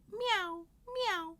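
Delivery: high, sing-song meow; high kitten mew; cat meowing. Acted. Cat arriving in a story.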